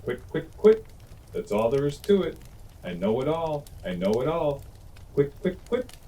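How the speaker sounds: a cocky little thrush showing off; chirpy thrush. A narrator's reading. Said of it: "cried the thrush".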